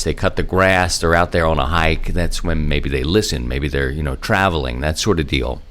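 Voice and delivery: ordinary talk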